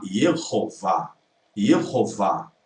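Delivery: Yehová slowly, h breathed, stress on vá; Yehová with audible h and v, final vá stressed; Yehová with breathy h, end stressed; Yehová, aspirated. Shown as a demonstration. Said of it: "é vá".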